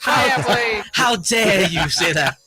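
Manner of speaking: hammed-up indignant cry, drawn out; slow it down as if deeply affronted; mock outrage. Host playing offended at a coach's slight, mock outrage.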